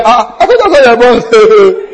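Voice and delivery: laughing through the senior's teasing line; quoting him while cracking up; laughing quote. Retold or imitated. Preacher retelling the senior's joke to the congregation, laughing.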